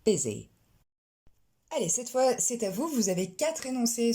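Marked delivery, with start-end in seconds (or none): 0.1-0.5 busy said as bizzy, short i; correct busy. Shown as a demonstration. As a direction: model the right vowel in busy. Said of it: "mais bien busy".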